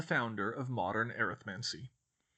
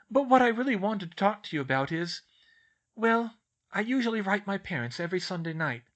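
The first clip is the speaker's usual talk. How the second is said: put-on higher, louder girlish voice; hesitant schoolgirl; 7 semitones higher, 6 dB louder than usual. Schoolgirl raises family worries with professor, hesitantly. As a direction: broach it awkwardly, pausing before the point. Hermione broaches a hard subject.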